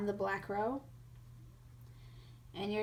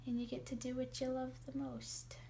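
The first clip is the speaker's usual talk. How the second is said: whispering; 3 semitones higher, 7 dB quieter than usual. Real, not acted. Whispered aside about dance.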